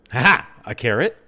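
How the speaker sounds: ordinary talk, nothing marked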